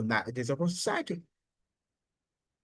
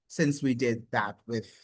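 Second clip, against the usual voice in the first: ordinary delivery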